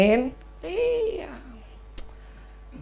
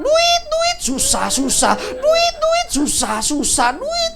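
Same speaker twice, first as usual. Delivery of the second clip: much higher, louder screeching; mock shrill yelling; 9 dB louder, much slower than usual. Husband imitating his wife screaming about money, high mock shriek. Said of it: "teriak-teriak".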